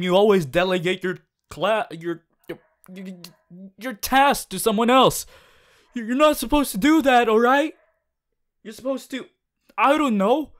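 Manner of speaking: loud, high, fast ranting. Acted. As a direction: let the frustration boil over at the lazy classmate. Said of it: "rant over".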